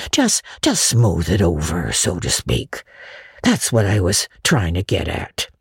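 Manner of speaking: put-on coaxing a girl to give in; shy old Matthew. A narrator's reading.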